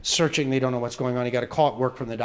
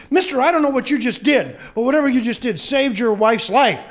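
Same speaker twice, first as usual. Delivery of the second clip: high, loud, excited praise; amazed doctor; 8 semitones higher, 6 dB louder than usual. Acted. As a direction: marvel out loud at a miracle.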